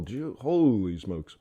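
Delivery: low, slow and soft holy smokes; soft slow Gee, holy smokes; hushed awe. Real, not acted. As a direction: murmur it in quiet disbelief. Quiet awed aside to himself.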